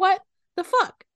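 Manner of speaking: high, loud, incredulous retold outburst; mock pissed-off. Podcaster retelling pissed Apollo at the fountains, mock outrage. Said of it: "Apollo's pissed".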